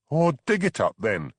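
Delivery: high angry snap; snarl. A narrator's reading. Suspect daring the constable to dig, angry snarl. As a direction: spit it out in anger. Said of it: "she snarled".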